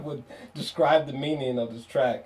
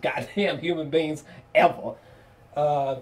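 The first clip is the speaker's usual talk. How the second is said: ordinary talk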